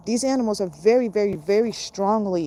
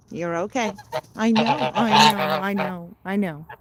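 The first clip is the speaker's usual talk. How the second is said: gentle reassuring coo; soothing. Real, not acted. Soothing pet goose.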